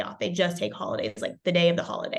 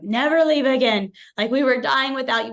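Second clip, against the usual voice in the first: loud, raised-pitch mock complaint; joking plea; 6 semitones higher, 8 dB louder than usual. Patients to the chiropractor after two weeks closed, mock pleading. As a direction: plead as a playfully dramatic patient. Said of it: "We were joking".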